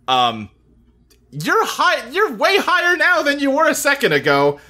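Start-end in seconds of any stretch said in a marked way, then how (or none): 1.3-4.6 excited. Acted. Describing being flung high.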